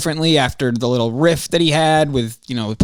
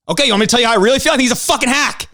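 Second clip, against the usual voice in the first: blurted anger; 7 semitones higher, much faster than usual. Real, not acted. Blunt outburst about Mike.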